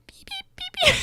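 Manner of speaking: very high, quiet beeping critter; wet impression. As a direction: beep softly like a tiny creature. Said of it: "I could do a really good wet impression".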